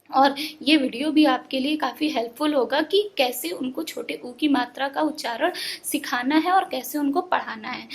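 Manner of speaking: normal talking voice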